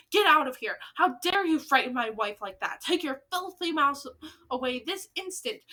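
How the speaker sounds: loud furious ordering; angry shouting. Acted. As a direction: throw them out, full volume. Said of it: "Mister Jenkins was towering over us and shouting".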